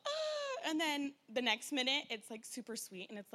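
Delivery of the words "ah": high mock shriek of the girl in the ad; gummy-ad girl's sour scream. Mother mimicking the sour-candy ad girl, high mock shriek. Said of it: "she's like".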